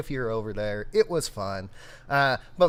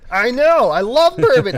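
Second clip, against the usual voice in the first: excited; 13 semitones higher, 14 dB louder than usual. Real, not acted. Excited agreement about bourbon.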